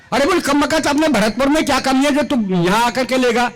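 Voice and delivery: ordinary talk, nothing marked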